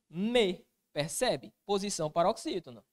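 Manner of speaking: ordinary talk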